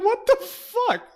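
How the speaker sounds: very high, slow laughing outrage; offended Bombur. Retold or imitated. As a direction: squeak out the dwarf's offended disbelief. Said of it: "he's like".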